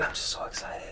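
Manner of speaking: breathy hushed excitement; giddy whisper. Retold or imitated. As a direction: confide your excitement under your breath.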